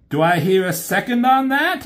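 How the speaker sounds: high, self-satisfied robot asking the room; Starscream voice. Retold or imitated. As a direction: call for the vote like a smug parliamentarian. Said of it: "He says".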